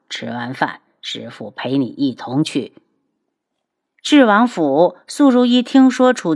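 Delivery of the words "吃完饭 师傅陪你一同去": put-on much lower, soft old master; gentle low elder; old man voice. A narrator's reading. (低沉老者声)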